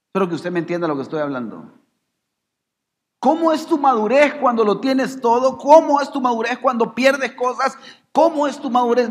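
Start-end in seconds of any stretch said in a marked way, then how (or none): 3.2-9.1 high, insistent rhetorical questions; full-force preaching. Acted. Preacher challenging congregation's maturity in gain and loss, full-force preaching. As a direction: drive each question at the congregation, pitched high.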